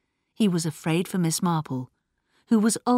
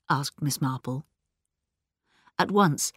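usual speaking voice

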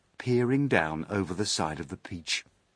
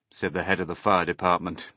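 usual speaking voice

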